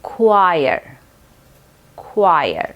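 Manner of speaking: choir as kwire, k sound; correct choir. Shown as a demonstration. Teacher modelling choir for students. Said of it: "एक खुआ sound बनाते हैं".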